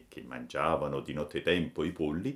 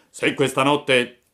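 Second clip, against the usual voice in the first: loud accusing farmer voice; angry farmer; 8 semitones higher, 12 dB louder than usual. A narrator's reading. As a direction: confront the chicken thief. Farmer orders.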